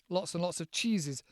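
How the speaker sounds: normal talking voice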